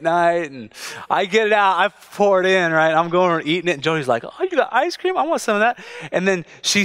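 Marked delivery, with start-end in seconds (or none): 4.2-5.8 wife's eager voice. Retold or imitated. Wife voiced in story.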